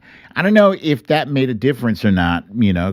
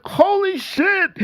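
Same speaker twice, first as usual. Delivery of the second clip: much higher, slow, awed holy shit; seeing the check mark at last, high and awestruck; stunned disbelief. Retold or imitated. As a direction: let it out slowly, floored by the surprise. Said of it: "I saw the check mark and I was like".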